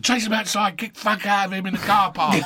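lightly hyped mate egging him on. Retold or imitated. Ian Wright urges a car-park fight, high. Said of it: "Ian Wright said".

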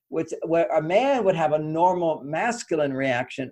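normal talking voice

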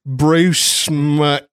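booming mock Australian self-introduction; Australian accent. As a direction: introduce yourself as a brash Aussie bloke. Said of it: "as you can tell by my accent".

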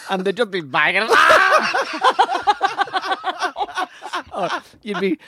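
talking through high laughter; laughing. Real, not acted. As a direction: laugh while telling it.

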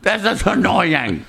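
agree, fed up but amused; exasperated agreement. Retold or imitated. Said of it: "I'm like".

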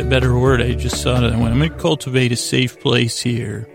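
ordinary talk, nothing marked

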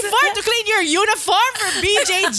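high, fast, loud disbelief; incredulous mock rant. Mom ranting to friends about the uniform, high incredulous disbelief. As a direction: spit out the question in mock outrage.

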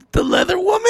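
high, whimpering disbelief; mock dread. Comic learns who likes him, mock horrified whimper. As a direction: squeak it out like a hammed-up whimper.

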